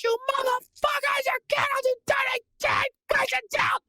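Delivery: high, strained mock tantrum; raging scream. Fan losing it at the game on TV, high raging scream. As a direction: go to ten, screaming at the players. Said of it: "from ten to one so fast".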